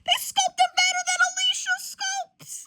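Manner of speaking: high, loud theatrical shriek; mock outrage. Comic character comparing the sculpture to Alicia's, high mock-outraged shriek. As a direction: shriek it in exaggerated disbelief. Acted outburst over the sculpture.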